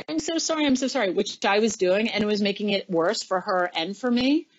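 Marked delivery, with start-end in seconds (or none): 0.1-1.1 mock groveling. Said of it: "grovel and be like".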